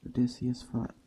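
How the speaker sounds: ordinary delivery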